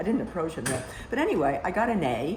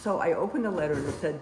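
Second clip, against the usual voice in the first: ordinary talk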